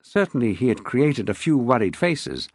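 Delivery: usual speaking voice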